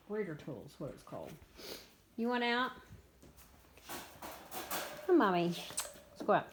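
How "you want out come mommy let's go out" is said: high loud call to a pet; talking to dog. Real, not acted. Calling the dog out.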